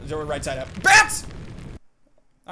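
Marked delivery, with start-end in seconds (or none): 0.8-1.3 sudden high yell; real surprised cry; startled shout. Real, not acted. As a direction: shout as bats swoop. Bats fly at him.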